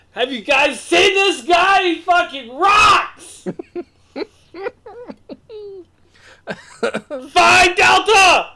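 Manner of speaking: loud drawn-out bro cheer; frat party yell. Pretend.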